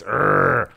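loud "urgh" growl; short forceful roar; lion growl. Shown as a demonstration. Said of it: "lion voice".

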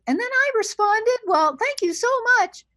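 very high-pitched caricature of a woman; mocking female voice. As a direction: mimic a man putting on a girly voice. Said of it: "don't do a stereotypical female voice".

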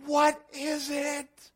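high scream, then slow, drawn-out question; panicked scream. Acted. Preacher imagining us in the boat, panicked scream. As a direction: act out high-pitched alarm, stretching the words. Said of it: "we'd be like".

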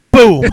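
loud, sharp boom; gunshot boom. Retold or imitated. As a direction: blast it out like a gunshot. Gunshot in retold fight scene.

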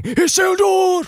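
high, slow, booming Elrond shout; Hugo Weaving impression. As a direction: bellow the name like Elrond. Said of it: "Hugo Weaving going".

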